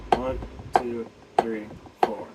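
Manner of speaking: steady count on each quarter note; counting accented quarters. Shown as a demonstration. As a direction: count one two three four evenly over the sixteenths. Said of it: "we're just accenting quarter notes".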